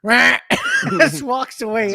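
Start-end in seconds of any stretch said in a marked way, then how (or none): 0.0-0.4 short duck squawk; imitated duck quack. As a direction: quack back like a defiant duck. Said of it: "the duck turns and just goes".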